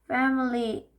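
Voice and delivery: plain reading voice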